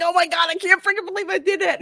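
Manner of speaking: spiralling at what he just did, fast; freaking out. Retold or imitated. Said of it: "starts freaking out".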